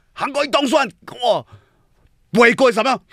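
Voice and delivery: high-pitched mock fan shouting; imitating heckling fans. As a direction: squeal it like a heckler in the crowd. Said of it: "那些韩粉就在旁边喊".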